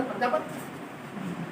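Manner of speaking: "प्रजापति" said softly and slowly, final i nearly dropped; imitating UP pronunciation. Teacher quoting puzzling UP speakers, soft short-i ending. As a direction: draw out "प्रजापति" softly, ending almost "prajapat". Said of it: "अब बोल रहे".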